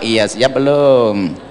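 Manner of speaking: slow patient ask. Pretend. Husband repeats, slowly.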